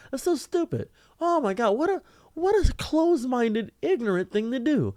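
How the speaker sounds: high-pitched incredulous outburst; exasperated disbelief. Real, not acted. Man reacting to a stranger's judgment, exasperated. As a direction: let the pitch fly up in disbelief.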